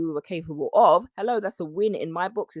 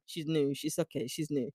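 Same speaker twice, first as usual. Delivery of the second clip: mock aside; 5 semitones lower, 8 dB quieter than usual. Locals quietly remarking on an outsider.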